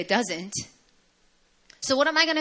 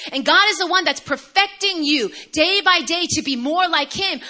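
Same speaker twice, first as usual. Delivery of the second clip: loud, high preaching build; 6 semitones higher, 6 dB louder than usual. Acted. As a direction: preach it out with fire. Preaching builds to full force.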